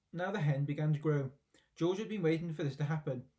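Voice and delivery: ordinary delivery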